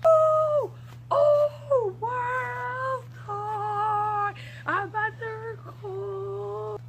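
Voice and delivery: excited shout. Acted. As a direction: burst out in delight. Excited outburst in the skit.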